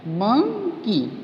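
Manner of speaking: "Monkey" very slowly, मं, pause, की; syllable by syllable. Shown as a demonstration. Teacher repeating monkey for students, slowly split in two. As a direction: stretch and separate both syllables of "monkey". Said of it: "टू syllable".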